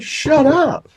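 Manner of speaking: high, loud "shut up"; loud, high plea to mom to stop; mortified teen. Retold or imitated. Embarrassed teen to mother.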